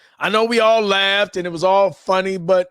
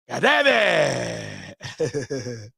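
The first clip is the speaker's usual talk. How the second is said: drawn-out goddamn; 5 semitones lower, 5 dB quieter, much slower than usual. Real, not acted. Drawn-out exasperated sigh.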